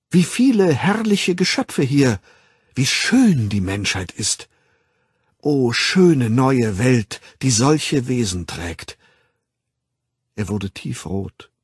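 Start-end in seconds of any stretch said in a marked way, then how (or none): 0.1-9.0 loud, slow, enraptured recitation; rapt declaiming. A narrator's reading. Young man quoting Miranda, declaiming with ardour. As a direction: declaim it like verse on a stage. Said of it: "deklamierte er mit leuchtenden Augen".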